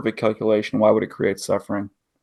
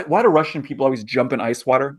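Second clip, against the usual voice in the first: ordinary talk, nothing marked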